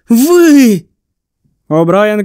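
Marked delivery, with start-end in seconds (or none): 0.1-0.8 one word spat high and loud; spat out in fury. A narrator's reading. Distraught boy spits a single word at the doctor, furious. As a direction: hurl it at the doctor like an accusation. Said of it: "Малакі виплюнув йому в обличчя одне тільки слово".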